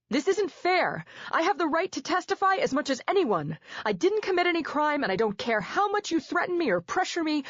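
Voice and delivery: high, loud furious protest; yelling at the judges. A narrator's reading. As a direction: rage against the unfair court. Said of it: "continuing to yell at the judges".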